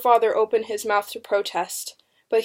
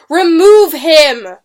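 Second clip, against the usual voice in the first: loud, slow, raised command; shouted order; 7 semitones higher, 15 dB louder, much slower than usual. A narrator's reading. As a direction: bellow the order to officers. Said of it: "Matthew ordered".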